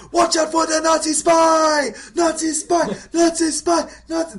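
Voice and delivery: screaming alarm. Retold or imitated. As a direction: sound the alarm at full volume. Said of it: "they're screaming other lines".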